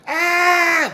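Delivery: high shrill mock scream, loud; girly scream. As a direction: shriek like a scared girl at a rat. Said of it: "screams like a girl".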